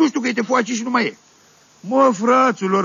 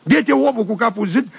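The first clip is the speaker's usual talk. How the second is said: ordinary delivery, nothing marked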